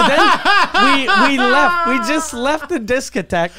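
high, loud laughing through words. Real, not acted. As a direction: laugh while telling it. Laughing while telling escape.

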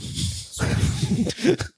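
high burst of laughter. Real, not acted. Amused by own request for another accent, laughing. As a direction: crack up laughing.

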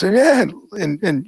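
ordinary delivery

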